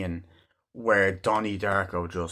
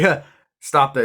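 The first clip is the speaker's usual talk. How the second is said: loud, higher snap back; angry retort; 5 semitones higher, 8 dB louder than usual. Retold or imitated. Donnie cutting in on the speaker, loud and angry. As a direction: shut him down hard. Film argument acted out.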